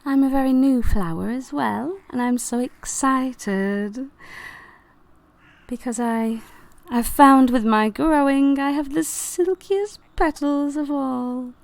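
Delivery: sweetly vain flower character; excited young flower. Acted. New flower boasts of its petals.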